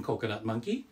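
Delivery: usual speaking voice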